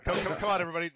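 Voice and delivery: usual speaking voice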